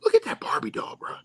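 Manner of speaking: hushed whisper of disbelief. Real, not acted. Pointing out the doll to viewers, whispered aside. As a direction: breathe it out like a secret.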